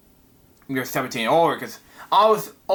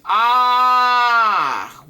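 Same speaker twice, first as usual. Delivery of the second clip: a loud, high cry of panic; hammed-up comic book scream; 4 semitones higher, 4 dB louder than usual. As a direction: scream as you run through the streets. Narration says you run screaming.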